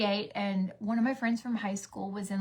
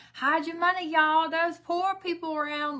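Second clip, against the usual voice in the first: high-pitched mock warning to y'all; raised, playful quoted catchphrase; imitated joke sound bite; 8 semitones higher, 5 dB louder than usual. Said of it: "You know that sound that we use".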